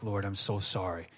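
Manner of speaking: low, soft, contrite; praying softly in shame; hushed apology. Retold or imitated. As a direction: murmur a sorry to God. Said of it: "you're like".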